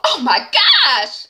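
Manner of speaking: slow, drawn-out scandalized exclaim; pearl-clutching shock, stretched out. Real, not acted. Host reacting to the episode's twist, slow and scandalized. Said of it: "I'm clutching my pearls".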